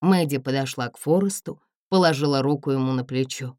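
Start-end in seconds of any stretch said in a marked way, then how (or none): none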